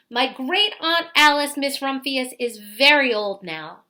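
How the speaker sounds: plain reading voice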